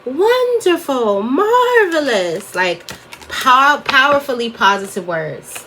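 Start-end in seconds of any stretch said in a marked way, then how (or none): 0.1-2.5 high, slow, savoring each word; drawn-out gushing jolly lady; imitating aunt. Niece mimicking her aunt's favorite words, high and slow. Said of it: "She just always say".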